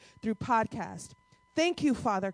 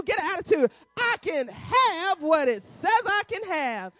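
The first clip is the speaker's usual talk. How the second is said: slow, higher, emphatic declaration; reading with conviction; 7 semitones higher than usual. Shown as a demonstration. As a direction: get an attitude and claim it. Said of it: "read it like you mean it".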